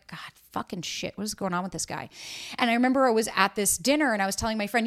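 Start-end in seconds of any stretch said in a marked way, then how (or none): 0.1-2.1 much softer, low grumbling aside to herself; muttered frustration. Retold or imitated. Frustrated by his vague plans, muttering to herself. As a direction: mutter it under your breath, fed up.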